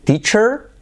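"Teacher" with a hard ch stop. Shown as a demonstration. Teacher contrasting it with t-shirt, clear ch. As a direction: slowly hit the ch in "Teacher". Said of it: "con C H".

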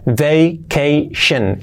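very slow "vacation": vay, kay, shun; slow syllable demo. Shown as a demonstration. Teacher slowing it down for students, syllable by syllable. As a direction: pull "vacation" apart syllable by syllable. Slow model after correction.

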